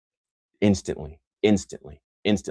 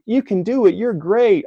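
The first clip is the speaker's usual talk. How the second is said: higher and louder, a cheesy cheerleader line; mock pep talk; 14 semitones higher, 6 dB louder, slower than usual. Mocking the empty encouragement he never gave, in a cheesy pep-talk voice. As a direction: toss off a hollow "you can do it" in a mocking pep-talk tone. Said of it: "I didn't say".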